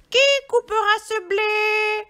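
high, loud squawky hen; little hen crying out. A narrator's reading. Red hen asking who will plant the wheat, high crying-out voice. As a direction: call out as an excited little hen. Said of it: "la petite poule rousse demanda".

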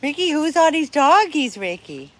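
playful pet talk. Real, not acted. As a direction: ask your little dog as if amazed. Teasing question to dog.

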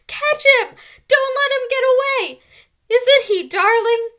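put-on high, loud girlish squeals; squealing. A narrator's reading. Excited girls chasing a mouse, squealing. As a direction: squeal it out in a frenzy. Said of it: "all the girls were squealing".

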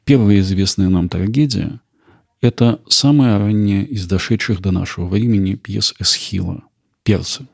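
ordinary talk, nothing marked

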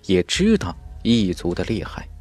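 ordinary delivery

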